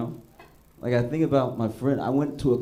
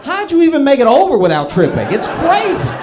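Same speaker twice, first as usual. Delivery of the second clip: much higher and louder, cracking up; loud laughing rant; 10 semitones higher, 12 dB louder than usual. Real, not acted. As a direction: shout it through your own laughter. Ranting about untied shoes, laughing.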